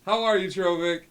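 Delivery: high, booming dad on a bad line; loud excited dad. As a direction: shout into the stone as an eager dad. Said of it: "it's Dad".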